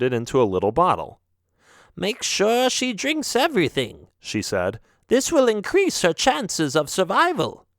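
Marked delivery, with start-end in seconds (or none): 1.9-7.7 put-on high old witch instructing. Voices the witch.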